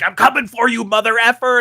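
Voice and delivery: loud, high imitation of a raging player; mock hostile threat. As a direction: yell it like an attacking gamer. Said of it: "they just start cursing and swearing at you".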